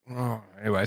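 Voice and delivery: ordinary talk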